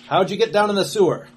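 a hint of a suspicious guardsman; stern guardsman. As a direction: press him like a guard. Guardsman keeps interrogating.

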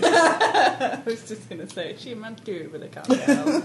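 words squeezed out through laughter; can barely talk for laughing. Real, not acted.

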